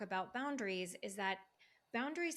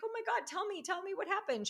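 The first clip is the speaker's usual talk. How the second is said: much higher, eager and thrilled; excited; 9 semitones higher, faster than usual. Retold or imitated. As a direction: beg for the news with delight. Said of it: "I was like".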